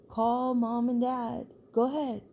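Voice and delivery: defiant dare. Retold or imitated. Brother dares her on the phone.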